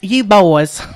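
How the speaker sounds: high, loud Southern mom scolding; imitating mom's mad Tennessee drawl; Tennessee accent. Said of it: "that Tennessee accent that comes out when she's mad".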